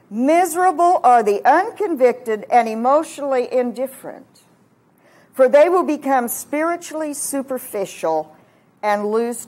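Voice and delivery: loud, emphatic pulpit declaration, slowing at the end; forceful preaching. Acted. Preaches a reversed beatitude.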